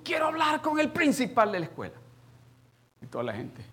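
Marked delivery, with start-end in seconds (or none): 0.0-1.9 loud, high demand; raised voice. Retold or imitated. Angry father at the school counter demanding the principal, raised voice. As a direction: call it out high across the office counter. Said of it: "era más alto".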